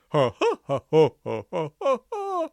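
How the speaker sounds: brassy villain cackle; sinister trombone laugh. A narrator's reading. Barnstable walks away from the locked dungeon, laughing sinisterly. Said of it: "laughing in a sinister, trombone-tinged way".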